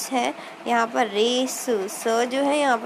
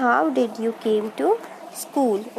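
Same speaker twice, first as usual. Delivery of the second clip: slow dictation; much slower than usual. Shown as a demonstration. Teacher re-dictating the question.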